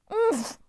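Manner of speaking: high grunt through a gag; gagged goddess protest; muffled complaint. A narrator's reading. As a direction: grumble through the sock. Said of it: "Nike complained".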